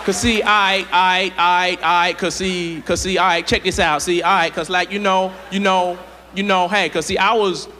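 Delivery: low, stalling street voice; rambling witness. Acted. Witness rambling in front of the news camera. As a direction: stall and ramble, lost for words.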